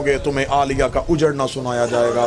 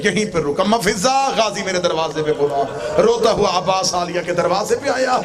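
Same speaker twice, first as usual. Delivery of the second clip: impassioned majlis recitation; 7 semitones higher than usual. Acted. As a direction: drive the climax of the masaib home. (ऊँची, जोशीली मजलिस की ख़िताबत)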